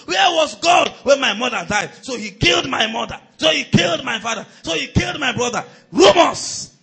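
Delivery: high, loud shouted preaching; loud preacher shouting over grief. Acted. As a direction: cry it out to the church. Preaching climax on grieving.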